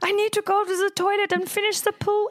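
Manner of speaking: high, hammed-up old woman voice, pitched well up; mock old lady voice. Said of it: "And he's imitating me".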